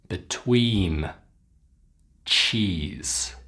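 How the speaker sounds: "sheep", "feet", "cheese" and the other words one by one with long ee; repeat-after-me modelling. Shown as a demonstration. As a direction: model each word from "sheep" to "cheese" separately, pausing for repetition. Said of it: "Repeat after me".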